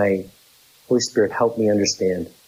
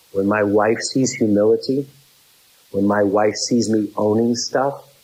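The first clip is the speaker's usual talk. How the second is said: ordinary delivery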